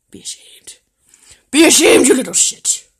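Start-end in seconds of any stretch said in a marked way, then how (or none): 0.1-0.8 very soft, voiceless reproach; hushed shaming whisper; whispered scolding. Real, not acted. Whispered take of scolding the dog. 1.5-2.1 loud, high shaming of the dog; sharp, high telling-off; loud scolding. Real, not acted.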